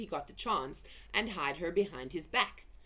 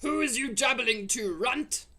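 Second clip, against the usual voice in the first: loud, high giant bellow; gruff giant yelling into the cave; booming giant; 5 semitones higher, 5 dB louder than usual. Acted. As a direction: call out like a brute giant.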